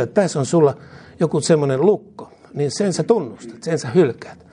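ordinary talk, nothing marked

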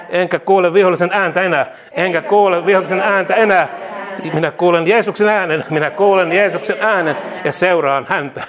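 loud, fervent call-and-repeat proclamation; rousing preacher declaring victory; preaching declarations. Acted. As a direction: proclaim each line boldly for the church to echo.

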